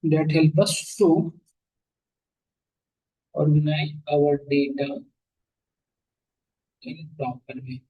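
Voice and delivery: very slow, broken into pauses between phrases; feed it phrase by phrase for note-taking; chunked slow dictation. Shown as a demonstration. Teacher repeating the definition for students to copy, very slow with pauses. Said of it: "ये लिख लो".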